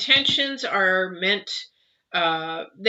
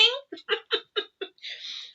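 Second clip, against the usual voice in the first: high breathy laugh; 7 dB quieter than usual. Real, not acted. Laughing at the repeated card.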